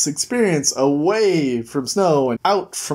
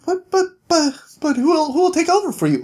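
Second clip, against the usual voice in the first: high, stammering mock panic; flustered protest; 11 semitones higher, faster than usual. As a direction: stammer it out, pitched way up. King Cole flustered by news.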